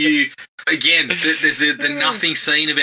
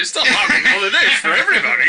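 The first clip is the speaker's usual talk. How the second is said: creature voice; 8 semitones higher, 4 dB louder than usual. Retold or imitated. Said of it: "he's like".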